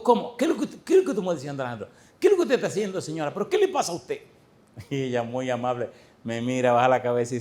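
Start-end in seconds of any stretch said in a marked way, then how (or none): 0.0-4.2 high, fast, incredulous questions; shocked questioning. Retold or imitated. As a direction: fire off amazed questions at her. Preacher acts his own shocked questioning.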